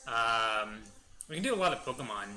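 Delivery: normal talking voice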